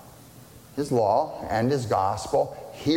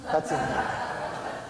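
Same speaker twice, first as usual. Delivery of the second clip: laughing; 3 semitones higher, 5 dB quieter than usual. Real, not acted. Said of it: "that's a wonderful text".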